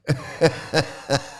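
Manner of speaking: a short airless laugh; breathy laugh. Real, not acted. Laughs at the clip.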